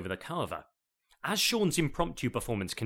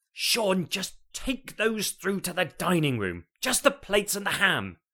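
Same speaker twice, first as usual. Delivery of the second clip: higher, louder, fed-up instruction; exasperated; 7 semitones higher, 5 dB louder than usual. Acted. Jane ordering her husband out of the kitchen, exasperated. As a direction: shoo him out, at the end of her patience. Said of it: "she said exasperatedly".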